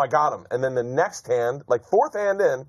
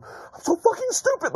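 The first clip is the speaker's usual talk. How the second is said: very high, slow self-loathing wail; hammed-up tearful self-blame, far above normal pitch; Scotty J. Loser on the escalator berating himself, mock wailing. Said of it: "like Scotty J and Boogie Nights".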